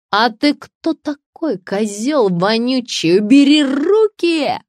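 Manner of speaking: high, drawn-out angry squeal; slow, loud shriek climbing in pitch; outraged screech. A narrator's reading. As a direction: screech at the man grabbing you. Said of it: "завизжала писательница".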